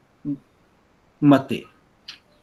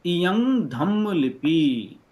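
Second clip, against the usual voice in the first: slow reading aloud; much slower than usual. Shown as a demonstration. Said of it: "तो इयम".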